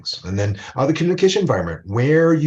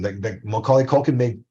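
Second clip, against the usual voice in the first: usual speaking voice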